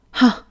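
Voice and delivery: one loud, high "ha!" of disbelief; scoffing laugh. Acted. Boss at employee who talks back, scoffing. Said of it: "하고 헛웃음을 치며".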